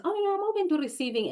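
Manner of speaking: high, quick mocking singsong; mock her fake openness; mock insincere claim. Mocking a woman who claims to be open.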